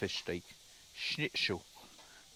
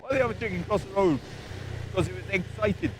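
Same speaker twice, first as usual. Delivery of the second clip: high, loud put-on joke voice, quicker; silly joke voice; 11 semitones higher, 9 dB louder than usual. Retelling the chicken joke to camera, hammed up. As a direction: rattles off the daft joke again.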